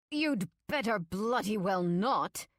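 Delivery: slow, emphatic threat; spat, ice-cold. A narrator's reading. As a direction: spit it out as a cold warning. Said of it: "He spits in that ice-cold accent".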